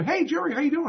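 high, bright call across the parking lot; cheery greeting voice. Retold or imitated. Man greeting Jerry in the lot, high cheery call. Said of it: "I hear this voice go".

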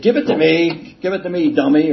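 mock members grabbing the reading; imagined impatience. Meeting members losing patience with a stutterer, mock impatient.

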